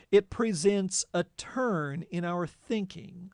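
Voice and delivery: normal talking voice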